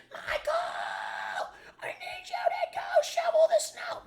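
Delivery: very high, loud screeching imitation of his mother; mocking his nagging mother with a high, loud screech; screeching mom. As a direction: screech it like a barking chihuahua. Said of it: "she would be screeching in the background like a banshee".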